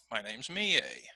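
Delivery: whispered "Mie" with a falling tune; stress on name. Shown as a demonstration. Teacher models student name practice in a whisper. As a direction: breathe out "My name's Mie" softly, ending low. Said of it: "you try it with your name".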